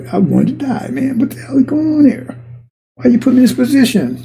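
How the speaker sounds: high, exasperated protest to God; Jonah complaining. Acted. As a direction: whine it up high like a fed-up prophet. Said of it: "We said".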